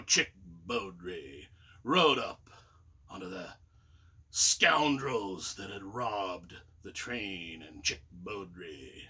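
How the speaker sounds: put-on low, slow old-West storyteller voice; old Western narrator. Imitating the books-on-tape narrator, low and slow. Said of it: "he talked in this old Western voice".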